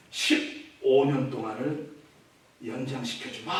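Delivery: God's solemn grant. Acted. God grants fifteen years.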